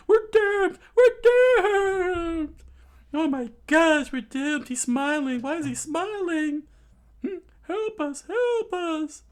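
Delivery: very high falsetto wailing; shrieky doom-crying crowd; hammed-up crowd panic. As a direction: wail in a squeaky mock panic. Panicking crowd voiced in story.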